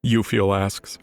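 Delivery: ordinary talk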